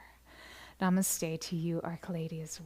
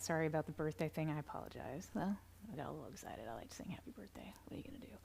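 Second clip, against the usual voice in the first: softer, fast whispered apology; sheepish aside; 3 semitones lower, 10 dB quieter, faster than usual. Real, not acted. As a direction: whisper it quickly, a bit embarrassed. Sheepish aside after singing.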